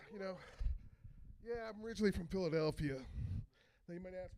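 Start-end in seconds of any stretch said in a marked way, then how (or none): none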